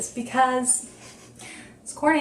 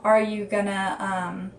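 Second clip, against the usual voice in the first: normal talking voice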